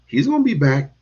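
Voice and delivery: normal talking voice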